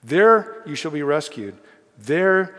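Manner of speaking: "There" punched loud and high, then a pause; emphatic double promise. Shown as a demonstration. Preacher shows congregation the repeated promise, "There" rung out loudly. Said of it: "It's repeated two times for emphasis".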